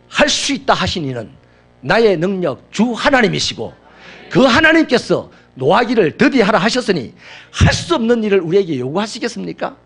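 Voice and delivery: loud, high, rising proclamation; full-force preaching. Acted. Pastor exhorts the congregation to master anger, loud preaching. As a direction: drive it home to the congregation.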